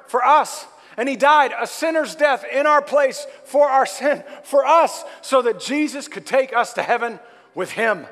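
loud, high, fervent sermon; preaching at full force. Acted. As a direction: preach it out to the crowd.

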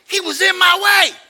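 put-on high, loud little-kid yell; protesting toddler. Preacher repeats grandson's excuse to crowd, high toddler yell. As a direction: imitate an indignant small child insisting.

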